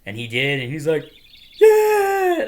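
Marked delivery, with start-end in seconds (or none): 1.6-2.5 very high, loud drawn-out scream; upset, pissed yell. Retold or imitated. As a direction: imitate a pissed-off player yelling. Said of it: "upset and pissed".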